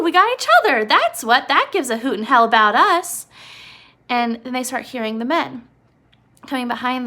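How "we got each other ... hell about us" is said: Lenny excited. Retold or imitated. Lenny finishing their familiar story, loud and excited. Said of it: "And Lenny says".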